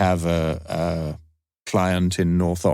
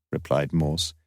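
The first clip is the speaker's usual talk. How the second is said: plain reading voice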